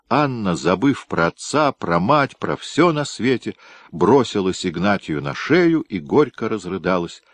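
usual speaking voice

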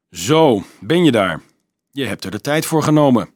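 a loud gruff baker's reproach; loud grumpy baker at the door; gruff growl. A narrator's reading. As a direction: scold him loudly for being late. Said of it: "gromde de bakker".

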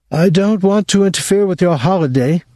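complaining mother. Acted. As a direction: play the put-upon mother. Mother voiced in story.

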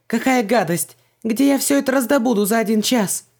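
very high, loud indignant wail; breaking into a squeal. A narrator's reading. Heroine faced with a revolting recipe, squealing. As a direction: shriek it in disgusted panic. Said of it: "сорвался на визг".

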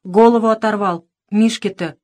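ordinary delivery, nothing marked

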